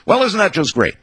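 high-pitched sarcastic complaint; snotty. A narrator's reading. Wife facing a litter of lizard babies, snotty complaint. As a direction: whine it snottily, pitched well up. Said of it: "she was being snotty here".

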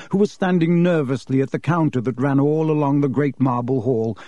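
plain reading voice

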